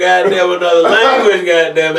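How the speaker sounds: high, loud, laughing through words. Real, not acted. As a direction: speak while cracking up. Laughing outburst.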